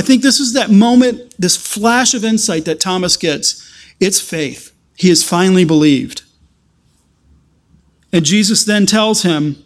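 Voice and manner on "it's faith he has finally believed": ordinary delivery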